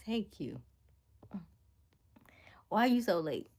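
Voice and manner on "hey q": whisper. Real, not acted. Greeting a late viewer quietly.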